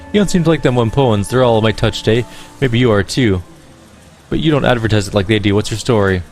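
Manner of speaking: put-on bright-toned Irish accent, musical lilt. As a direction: play her as a lyrical Irish gamer woman. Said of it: "that's the voice I chose for her".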